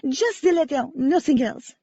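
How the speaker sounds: rattled French woman; shivering French accent. A narrator's reading. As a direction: answer tensely in a French accent. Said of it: "on a violent shiver".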